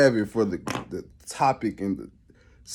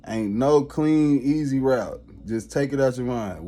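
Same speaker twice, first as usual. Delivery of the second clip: ordinary delivery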